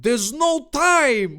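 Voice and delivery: high, slow, loud cry; hammed-up urgency, words stretched out; Dave. Dave rejects calling the police, crying out high. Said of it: "Dave said".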